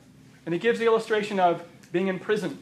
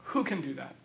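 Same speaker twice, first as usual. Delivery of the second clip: soft, low, almost to himself; preacher quietly letting the question sink in; hushed echo; 7 semitones lower, 8 dB quieter than usual. Acted. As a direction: drop to a near-hush on the repeat.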